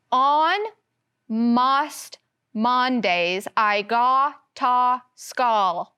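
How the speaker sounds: "On most Mondays, I go to school" with every O said short ah: "on mahst Mahndays, I gah tah schahl"; silly short-O reading; all O's as short aw. Shown as a demonstration. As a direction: slowly turn each O into ah. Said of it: "with the short O sound, like in on".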